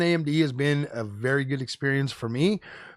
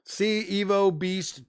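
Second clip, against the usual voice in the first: usual speaking voice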